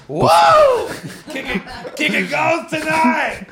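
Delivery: high, drawn-out whoop; mock cheering shout. Co-host hooting after being put in his place, mock cheering shout. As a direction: cheer it out very high, slowly, half laughing.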